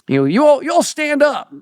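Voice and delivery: hammed-up preacher inviting visitors to stand; imitating the preacher. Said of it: "he said".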